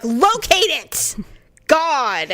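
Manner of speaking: high, drawn-out retold scolding; mock exasperated outburst. Wife retells scolding her husband over allergy medicine, exasperated. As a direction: imitate losing patience, stretching the words. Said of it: "I get really mad at him".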